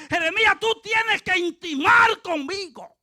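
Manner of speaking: loud, high preacher voicing God; God's urgent call. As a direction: call out forcefully to the prophet. Acts God urging Jeremiah.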